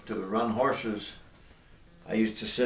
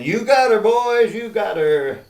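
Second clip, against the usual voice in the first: high, loud cheering holler; yelling; 11 semitones higher, 11 dB louder than usual. Acted. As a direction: shout it from the truck cab. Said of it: "Al yelled up again".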